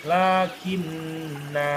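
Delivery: very slow "lakinna" with the last a held long; stopped, lengthened ending. Shown as a demonstration. Teacher shows the pausal reading, long "lakinna". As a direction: hold the final "na" of "lakinna". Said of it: "dibaca berhenti di situ".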